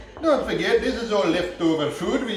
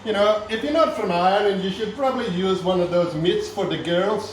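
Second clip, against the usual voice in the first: thick put-on Irish accent throughout. Comic Irish chef hosting a cooking show, in an Irish accent. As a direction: lay on the Irish accent like a TV cook.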